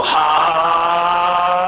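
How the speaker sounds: long held, high "haaa"; Dragon Ball Z power-up yell. Acted. Joking about what to do on knockdowns, anime power-up yell. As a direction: imitate an anime charge-up cry. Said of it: "start doing the Dragon Ball Z".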